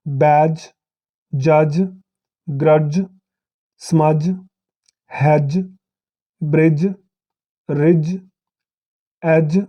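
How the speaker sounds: each word said alone, "ब्रिज" and "जज" ending on a single j sound; careful list, every dge ending said as one "ज", slowing at the end. Shown as a demonstration. Teacher to students, modelling the dge ending as j. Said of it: "तो इसकी examples देखते हैं कुछ".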